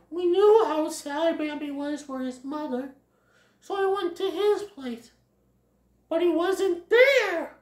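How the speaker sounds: high-pitched character voice; worried Flower voice. Acted. Flower reports on searching for Bambi, high worried voice. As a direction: pitch it up, an anxious little animal.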